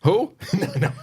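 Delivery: sharp, high, loud startled question; surprised. Real, not acted. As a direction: blurt it out in shock. Shocked reaction to the Today Show.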